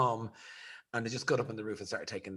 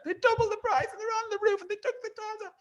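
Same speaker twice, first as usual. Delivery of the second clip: much higher, louder, frantic retelling of mum; upset mother; 5 dB louder than usual. Retold or imitated. Son mimics his mother panicking over the roof, high and frantic.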